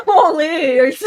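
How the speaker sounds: loud, high burst through laughter; real delighted laughing; laughing outburst. Real, not acted. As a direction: exclaim it while cracking up. Startled laughing at Austin's arrival.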